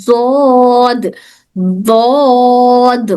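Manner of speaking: زود held very long with a z sound; drawn-out zod. Shown as a demonstration. Teacher contrasting zod against dhad for students, very slow. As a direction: stretch زود to show where the tongue does not touch. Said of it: "زو میں زبان ویسے نہیں touch ہو رہی".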